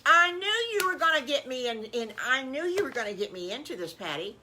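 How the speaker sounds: loud, high wail of complaint; fussing Aunt Petunia, easing down toward the end; exasperated aunt. Acted. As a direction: protest being roped in, hammed-up.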